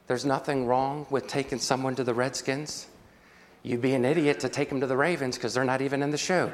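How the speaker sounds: usual speaking voice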